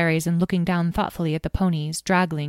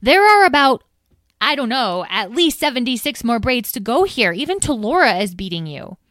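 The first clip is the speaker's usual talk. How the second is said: higher, louder exasperated scold; impatient scolding; 7 semitones higher, 5 dB louder than usual. Acted. Edwene scolding daydreaming Tom at braiding, impatient and loud. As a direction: snap him out of it, stamping. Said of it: "Edwene stamped her foot".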